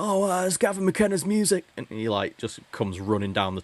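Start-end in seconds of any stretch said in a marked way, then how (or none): 0.0-1.6 high-pitched mock ringside excitement; excited wrestling commentator. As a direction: call a surprise entrance like a wrestling announcer. Mimics Royal Rumble commentator's excitement.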